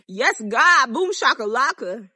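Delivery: loud, joyful celebration yell; triumphant shout. Real, not acted. Vlogger celebrating the noisy man finally leaving, loud cheer. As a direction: cheer out loud like you just won.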